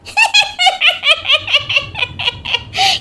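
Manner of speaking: long, very high cackling witch laugh. Acted. Disguised witch laughs at her mother-in-law, high cackle. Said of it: "चुड़ैल style से हंसती है".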